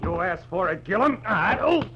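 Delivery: high, loud, strained mid-punch; fighting words. Acted. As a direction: taunt him while throwing punches. Fistfight, Marlowe punching Gillum.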